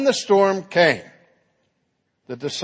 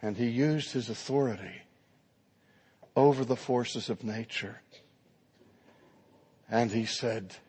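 usual speaking voice

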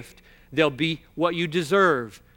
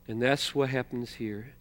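normal talking voice

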